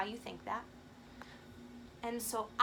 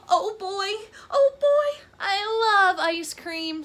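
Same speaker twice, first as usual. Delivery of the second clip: much higher and louder, squealing joy; ecstatic; 9 semitones higher, 13 dB louder, slower than usual. Acted. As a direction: lose it with pure ice cream delight. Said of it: "says Gerald".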